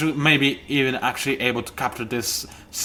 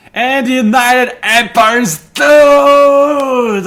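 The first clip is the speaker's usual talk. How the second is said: triumphant shout; 10 semitones higher, 10 dB louder, much slower than usual. Real, not acted. Gloating over defeated rival empire.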